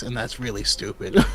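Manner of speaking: ordinary talk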